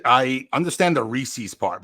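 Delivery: ordinary talk, nothing marked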